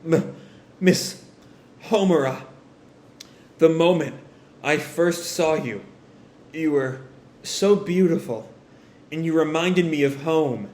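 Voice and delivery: halting dying words, broken by pauses; acted last confession, forcing out each phrase; struggling to speak. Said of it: "trying to hold on".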